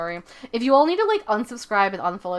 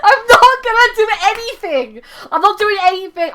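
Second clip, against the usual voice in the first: high, loud, giggly denial; laughing protest; 10 semitones higher, 8 dB louder than usual. Real, not acted. Streamer denies doing anything, high laughing protest. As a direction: protest loudly, cracking up.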